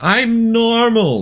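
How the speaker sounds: high, drawn-out mock self-assurance; self-assured claim. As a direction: stretch it out way up high, playfully. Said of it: "You always hear your own accent and you think".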